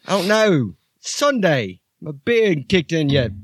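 high, shouty bossy bloke yelling at the lads; Archie character voice. Acted. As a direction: bark orders at the crew in a high put-on voice. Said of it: "This is Archie".

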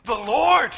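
"The Lord" with Lord punched loud; emphasis on Lord. Shown as a demonstration. Preacher shows Isaiah's amazement, Lord stressed loud. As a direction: hit "Lord" hard and let it fall. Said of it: "See the emphasis on the Lord?".

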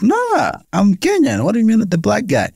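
high-pitched incredulous pushback; exaggerated disbelief at being called that; indignant retort. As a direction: act baffled and offended by the label. Retorting to a stereotyping question.

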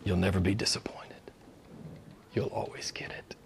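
hushed whisper; whispered assurance. Acted. Preacher assuring the congregation, whispered. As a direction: confide it gently.

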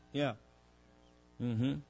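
ordinary talk

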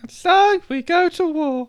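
high loud battle cry; epic declaration. Acted. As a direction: declare war grandly. Dramatic war declaration.

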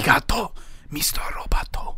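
put-on whispered Japanese accent.

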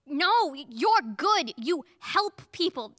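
slow desperate plea; pleading. Acted. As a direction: beg her slowly. Pleading with the godmother.

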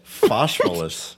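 "Phosphorus" drawn out very slowly; slow pronunciation. Shown as a demonstration. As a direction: stretch out "Phosphorus" syllable by syllable. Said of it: "you can't pronounce shit".

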